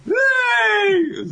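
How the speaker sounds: very high squealed cheer; hammed-up falsetto delight; gleeful bride. Bride thrilled by a lukewarm compliment, squealing glee.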